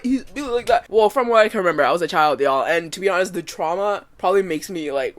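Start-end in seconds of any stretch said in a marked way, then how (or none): none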